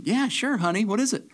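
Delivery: high-pitched, friendly waitress voice. Acted. Voicing a waitress in a joke.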